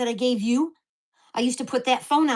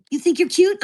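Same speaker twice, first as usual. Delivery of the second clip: higher, louder mocking quote; mocking lady neighbor; 6 semitones higher, 8 dB louder than usual. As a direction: throw it out as a loud, high challenge. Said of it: "the lady over here goes".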